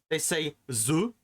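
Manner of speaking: "the" said as a French "ze", drawn out slowly; French accent. Shown as a demonstration. A French speaker saying "the" as "ze". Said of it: "instead of saying the".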